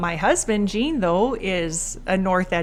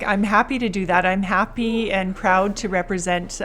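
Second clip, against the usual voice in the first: ordinary talk, nothing marked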